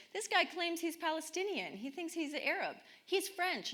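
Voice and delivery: a hint of a scoffing man; mocking man. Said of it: "and he says".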